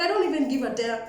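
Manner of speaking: ordinary delivery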